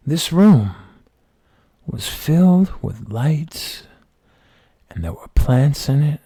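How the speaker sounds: usual speaking voice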